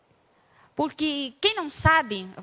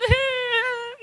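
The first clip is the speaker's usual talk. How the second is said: bursting laugh; 9 semitones higher than usual. Real, not acted. Laugh during excited retelling.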